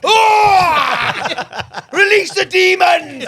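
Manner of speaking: slow, high mock-epic yell; loud, high comic roar; hammed-up shout. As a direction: bellow it like a mock exorcism, laughing. Mimics noisy man in next cubicle.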